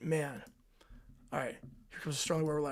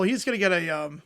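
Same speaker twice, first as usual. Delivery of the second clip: ordinary talk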